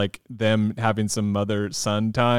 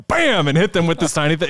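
excited; 6 semitones higher than usual. Retold or imitated. Retold surprise hit in combat.

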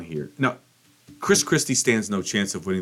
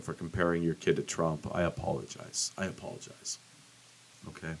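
whispered apology; 6 semitones lower, 12 dB quieter than usual. Real, not acted. Muttered apology.

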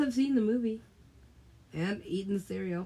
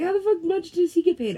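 higher, louder disbelief; mock outrage; 10 semitones higher, 8 dB louder, faster than usual. Complaining about the manager's pay, mock outrage. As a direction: blurt it out indignantly. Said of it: "I'm like".

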